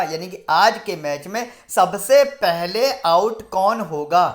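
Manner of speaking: loud, drawn-out question, word by word; emphatic re-asking. Real, not acted. Host re-asks viewers who hear the question wrong, loud and emphatic. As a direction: hammer the question home, stretching the key words.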